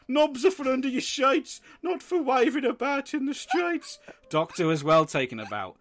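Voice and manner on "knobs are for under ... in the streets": high sing-song blurted rhyme; blurting dad. Retold or imitated. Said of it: "my dad just blurted out".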